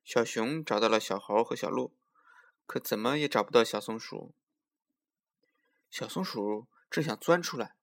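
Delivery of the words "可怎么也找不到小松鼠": ordinary delivery, nothing marked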